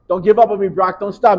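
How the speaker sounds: loud pleading encouragement; urgent coach shout. Retold or imitated. Coach to player mid-crawl, loud urging. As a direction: push him on at full voice.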